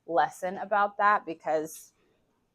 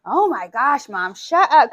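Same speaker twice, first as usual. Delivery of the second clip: put-on louder, higher fed-up teen groan; annoyed kids; 4 semitones higher, 8 dB louder than usual. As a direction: brush Mom off, exasperated. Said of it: "they're like".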